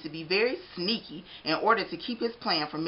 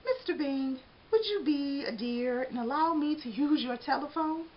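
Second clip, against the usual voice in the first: extra polite; 7 semitones higher than usual. A narrator's reading. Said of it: "Arnie asked extra politely".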